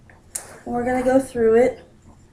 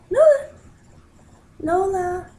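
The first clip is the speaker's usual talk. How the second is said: loud high call; 9 semitones higher, 3 dB louder than usual. Real, not acted. Owner calling her hiding cat, loud high call. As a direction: call the cat out from behind the laptop.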